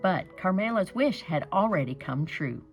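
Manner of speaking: plain reading voice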